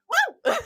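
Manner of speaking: high, mock cheer from the audience; one stray voice whooping; lone crowd whoop. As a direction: imitate a solitary fan's woo. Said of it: "that one voice that goes".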